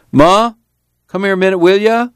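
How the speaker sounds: high call to Ma. A narrator's reading. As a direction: call her over. Tom calling Ma.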